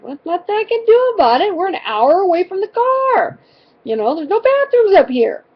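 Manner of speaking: high-pitched, fed-up parent retort; mock-exasperated, voice climbing high; exasperated protest. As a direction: retell it as a defensive, worked-up comeback. Retold argument with grumpy daughter.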